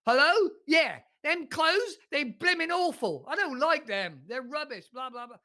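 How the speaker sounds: high, loud, coarse caller; imitating a rough uneducated caller; mock common caller. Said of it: "somebody that's less educated".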